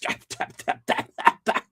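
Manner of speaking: wheezing unvoiced laugh; breathless laugh. Real, not acted. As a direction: laugh through it. Laugh amid disbelief.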